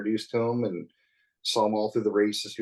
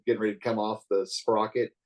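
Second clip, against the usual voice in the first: ordinary delivery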